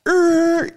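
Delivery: high, loud screeching brake noise; imitated tire screech. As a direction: mimic skidding tires in a high squeal. Imitates car braking hard.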